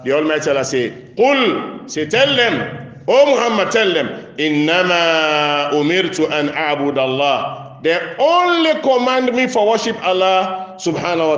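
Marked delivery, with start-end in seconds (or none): 1.1-11.4 raised, high-pitched sermon delivery with drawn-out recitation; preaching. Real, not acted. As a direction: proclaim the scripture and translate it forcefully.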